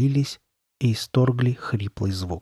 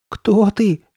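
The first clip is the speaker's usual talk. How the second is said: high, louder frightened question; trembling voice; 10 semitones higher, 6 dB louder than usual. A narrator's reading. Steve to the masked figure, trembling voice. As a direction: ask it high, as a scared boy. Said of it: "спросил Стив дрожащим голосом".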